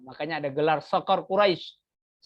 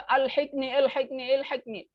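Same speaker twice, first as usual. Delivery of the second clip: high-pitched repeated mock plea; cry for help; 8 semitones higher than usual. Shown as a demonstration. Teacher giving another Arabic call for help, acted plea. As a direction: call out as if needing rescue. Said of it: "kalau meminta tolong".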